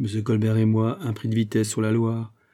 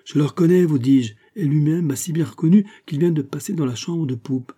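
higher, agitated insistence; emphatic insistence; 5 semitones higher, 4 dB louder than usual. Acted. Gourville insisting to doubting Fouquet, emphatic. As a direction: insist on it, pitch lifted and emphatic.

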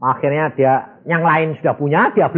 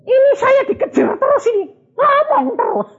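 1 pestered exclaim. Retold or imitated. Preacher mimics being pestered.

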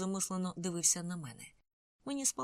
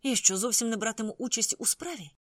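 higher, louder indignant protest; indignant outburst; 6 semitones higher, 6 dB louder than usual. A narrator's reading. Andrew protesting to Salman, indignant and louder. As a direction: demand to know why he is left out. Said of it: "спалахнув Ендрю".

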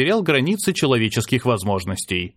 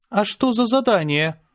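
cautious; 8 semitones higher than usual. A narrator's reading. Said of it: "осторожно спросила Аня".

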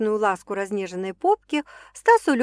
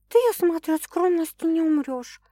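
sour; 8 semitones higher than usual. Acted. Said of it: "кисло отозвалась она".

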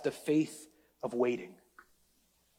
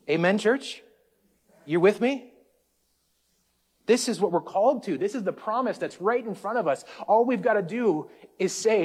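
higher and louder, rousing the congregation; full-force preaching; 5 semitones higher, 4 dB louder than usual. Acted. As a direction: build the sermon to a call-and-response peak.

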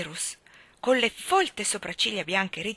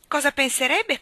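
higher, louder sly jab; sly taunting question; 7 semitones higher, 7 dB louder than usual. A narrator's reading. Trix slyly taunting her father at dinner, higher and louder. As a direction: needle him with mock innocence. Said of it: "continuò Trix".

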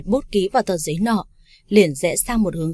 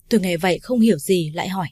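usual speaking voice

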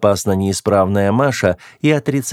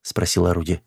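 plain reading voice

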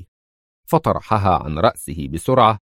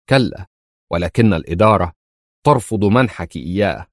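usual speaking voice

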